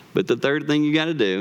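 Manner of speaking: ordinary talk, nothing marked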